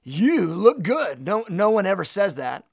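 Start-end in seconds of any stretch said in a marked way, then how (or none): 0.0-1.2 loud, pompous Behold, then a slow you look good; a silly overblown greeting; mock grand pronouncement. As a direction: ham up an old-fashioned announcement. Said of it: "walks into a room and goes".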